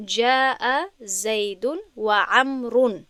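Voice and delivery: slow, careful Arabic example; slow example reading. Shown as a demonstration. Teacher reading an Arabic example slowly to students.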